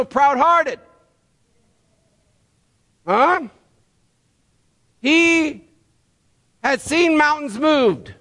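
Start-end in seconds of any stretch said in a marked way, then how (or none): none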